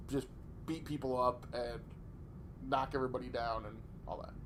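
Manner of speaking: muffled behind a hand; talking into your hand; hand over mouth. Real, not acted. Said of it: "Get your hand away from your mouth".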